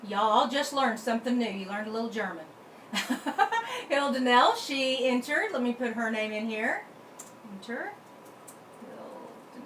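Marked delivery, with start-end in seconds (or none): 2.9-3.8 laugh. Real, not acted. Amused at her German lesson.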